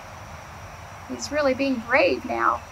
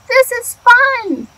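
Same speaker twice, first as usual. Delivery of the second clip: high, slow, louder cheer; happy animals; 10 semitones higher, 8 dB louder, slower than usual. Acted. The barnyard animals cheering, high and slow. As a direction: sing it out like delighted animals.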